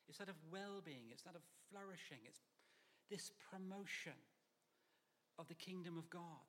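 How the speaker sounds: ordinary talk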